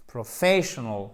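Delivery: PROFESSIONAL stressed on FE, ending reduced; accent tonique. Shown as a demonstration. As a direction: lean on FESS in "Professional", swallow the rest. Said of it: "Accent tonique". (Professional, accent sur FE, fin réduite)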